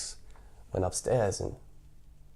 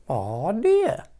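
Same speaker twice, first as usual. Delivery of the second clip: high, squeaky little cub; baby bear voice; 9 semitones higher, 3 dB louder than usual. Acted. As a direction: cry it like a tiny bear cub. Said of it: "cried the baby bear in his wee little voice".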